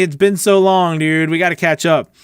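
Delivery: ordinary talk, nothing marked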